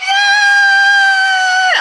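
high, loud joyful yay; loud cheer. Real, not acted. Fan cheers the finale loudly. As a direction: cheer at the top of the voice.

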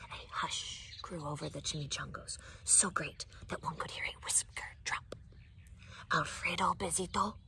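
soft whispered narration; hushed storytelling. A narrator's reading. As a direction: draw listeners in with a whisper.

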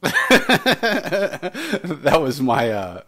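laughing through the words; teacher laughing at own squeaky voice. Real, not acted. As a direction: giggle out the explanation.

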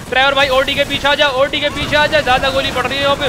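high-pitched urgency in a firefight; urgent calling out. Pretend. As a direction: call for cover as bullets fly. Urging himself to cover under gunfire.

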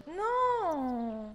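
high dismayed no. Real, not acted. Baby starts crying again, high dismayed no. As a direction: protest at the game.

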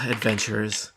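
low, soft sigh of resignation; exasperated mutter after a sigh; weary exasperation. Acted. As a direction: sigh it out, tired of the lot. Sheriff weary of adventurers.

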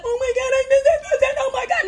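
high, delighted squeal of surprise; excited squeal. Retold or imitated. Retelling her joy when they reach out, high squeal.

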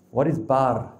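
ordinary talk, nothing marked

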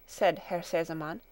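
usual speaking voice

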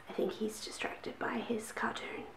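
hushed whisper so nobody wakes; breathy early-morning whisper. Real, not acted. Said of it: "I'm whispering because I don't wanna wake anyone up".

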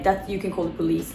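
ordinary delivery

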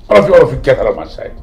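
father's furious send-off; angry dismissal. Acted. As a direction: shout them out of the room. Father sends children away.

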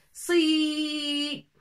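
"Si" held long with a stretched i. Shown as a demonstration. Teacher showing a longer "si" with ya. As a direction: draw out the "i" of "Si". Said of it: "avec ye, on la prononce plus".

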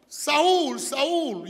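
loud ringing call of a name. Acted. Preacher booming God's call to Saul.

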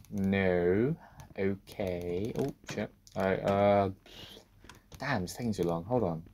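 usual speaking voice

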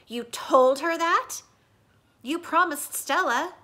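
hurt anger at a broken promise; angry. Acted.

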